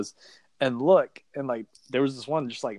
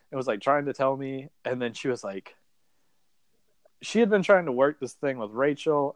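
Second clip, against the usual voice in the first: usual speaking voice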